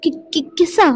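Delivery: stumbling over every word, high and shy; flustered stammer. Acted. Wife stammers when husband asks for a kiss, flustered and high. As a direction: get tangled in it, too embarrassed to say kiss.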